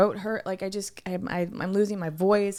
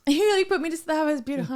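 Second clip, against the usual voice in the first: laughing; 6 semitones higher, 3 dB louder, much faster than usual. Real, not acted. Laughing at friend's soothing advice.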